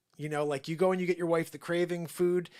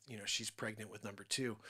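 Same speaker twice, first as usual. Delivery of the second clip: ordinary delivery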